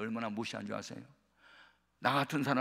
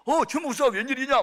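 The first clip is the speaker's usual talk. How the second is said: startled greeting, much higher and louder; surprised; 12 semitones higher, 9 dB louder than usual. Retold or imitated. Old pastor startled to see Pastor Choi at his door. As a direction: act caught off guard by a visitor. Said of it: "막 놀래가지고".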